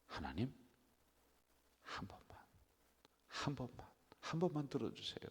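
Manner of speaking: hushed, then rising begging; retold tearful prayer; desperate plea. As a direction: whisper and build the begging. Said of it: "제가 지난주에 어떻게 했어요?".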